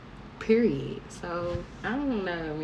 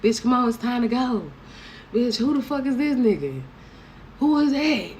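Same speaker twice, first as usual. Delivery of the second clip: mock friends yelling; 7 semitones higher, 3 dB louder than usual. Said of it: "Your girls had to come dragging you out the bathroom talking about".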